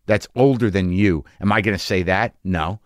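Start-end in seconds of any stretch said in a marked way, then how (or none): none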